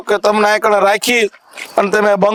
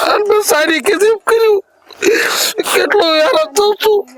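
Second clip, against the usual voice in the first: crying father; 8 semitones higher than usual. Acted. Father weeping over son.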